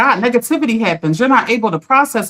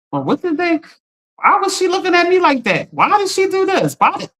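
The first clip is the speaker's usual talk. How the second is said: higher fretful questioning; anxious brain; 7 semitones higher than usual. Retold or imitated. Acting out her viewers' worried brain, higher fretful voice. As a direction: voice the worried inner voice replaying a slight. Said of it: "Your brain will remember that".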